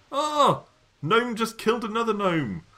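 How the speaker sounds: sudden high-pitched, loud shock; surprised shout. Real, not acted. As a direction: blurt it out in disbelief. Sees one gnome kill another.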